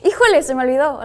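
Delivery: loud, fast híjole exclamation; exclaiming. Retold or imitated. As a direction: blurt it out like you just remembered. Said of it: "híjole, es como de".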